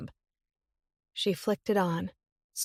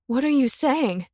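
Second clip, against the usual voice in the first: louder, higher alarm; alarmed sister; 6 semitones higher, 7 dB louder than usual. Acted. Willow alarmed at her sister's words, frightened. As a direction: demand it in alarm.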